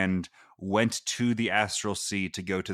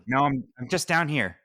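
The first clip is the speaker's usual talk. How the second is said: little voice; 6 semitones higher than usual. Acted. Tiny receptionist answers from below.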